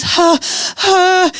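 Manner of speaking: high, mimicked labor panting; imitated heavy, high breaths; contraction breathing. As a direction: huff through a contraction. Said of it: "they had another one".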